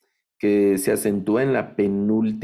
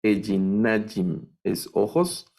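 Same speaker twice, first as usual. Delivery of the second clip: normal talking voice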